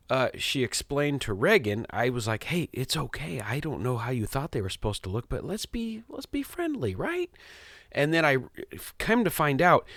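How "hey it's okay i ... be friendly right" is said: put-on soft, much higher, coaxing voice for a child; gentle coaxing. Dad gently coaxing his shy daughter to be friendly, soft and high. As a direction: soothe a shy little girl, softly and high.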